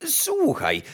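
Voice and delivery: high-pitched courtly interruption; interrupting chamberlain. A narrator's reading. Chamberlain interrupting the kitchen girl, high and officious. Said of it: "przerwał szambelan".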